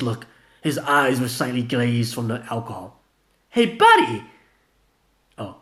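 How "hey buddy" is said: high, loud fake-cheery greeting; forced friendliness. Gina greeting Vanilla, overly friendly call. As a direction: greet too brightly. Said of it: "greeted him with deliberate friendliness".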